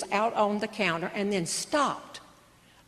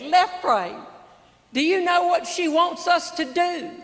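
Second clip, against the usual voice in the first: raised voice; 5 semitones higher than usual. Retold or imitated. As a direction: bring the voice up so he can hear. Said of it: "I brought my voice up".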